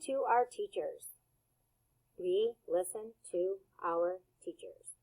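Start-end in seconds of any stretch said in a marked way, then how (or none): 2.2-5.0 quieter, careful repeat of the sentence; soft model repeat. Shown as a demonstration. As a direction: say "We listen to our teachers." softly and evenly. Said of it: "repeat that sentence".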